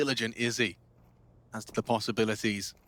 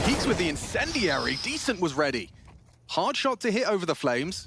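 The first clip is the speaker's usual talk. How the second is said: excited call; 7 semitones higher, 3 dB louder than usual. Real, not acted. Caster calls an incendiary peek.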